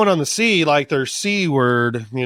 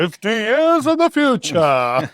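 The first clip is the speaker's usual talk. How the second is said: higher, drawn-out hammy announcement; mock futuristic announcer; 8 semitones higher, 4 dB louder, slower than usual. Joking to co-host about the next time jump, mock announcer. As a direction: announce it like a cheesy title card.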